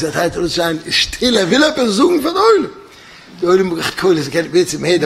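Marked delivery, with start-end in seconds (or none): 0.9-2.7 imitated pilot yelling. Pilot hushing the whole cabin, retold yell. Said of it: "Top of his Lungs".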